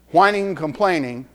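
ordinary talk, nothing marked

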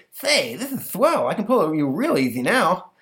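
put-on pleased boy; delighted boy. Stanley pleased the monster walks the crate, high. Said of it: "Stanley delighted".

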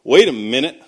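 loud, jolted realization; sudden realization. Retold or imitated. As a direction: burst out as it suddenly clicks. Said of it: "those mind-blowing moments where it clarified".

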